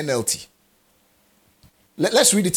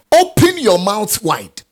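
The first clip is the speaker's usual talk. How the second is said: louder, slow, ringing declaration; proclaiming; 6 semitones higher, 8 dB louder, slower than usual. Acted. Preacher booms the verse at the congregation, loud and slow. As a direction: call it out like a command from the pulpit.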